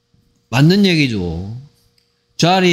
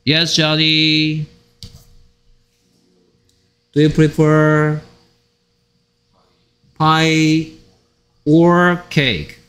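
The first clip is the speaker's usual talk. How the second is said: slow model reading; much slower than usual. Shown as a demonstration. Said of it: "or 들어가는 거 조심하라 했습니다".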